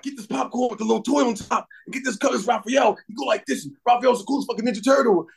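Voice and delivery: excited fanboy rush; excited kid fan. Retold or imitated. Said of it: "like, my daughter, like".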